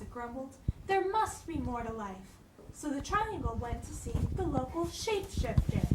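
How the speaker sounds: put-on soft, gentle read-aloud for children; storybook narration for the kingdom; story reading. Reads picture book aloud.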